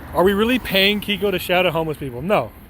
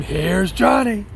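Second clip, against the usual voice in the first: slow, drawn-out movie-villain "Here's Johnny"; mock Shining line, half-voiced; 4 semitones higher, slower than usual. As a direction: stretch it like a horror-film axe man, no real scream. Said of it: "and scream".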